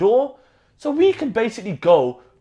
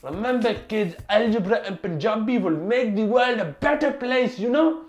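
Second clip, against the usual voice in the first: put-on Indian accent. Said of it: "Mister Singh was all about relating".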